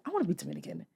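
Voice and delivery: put-on soft, small kid voice; a little kid quietly pleading; childish wish. Child tells mom softly she wants to be Dominican. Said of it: "I'm like".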